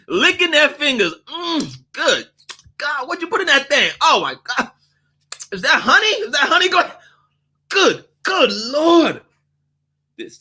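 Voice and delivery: high, overblown praise of the food, then a flat demand; hammed-up customer. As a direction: gush like it's heaven, then flip to a complaint.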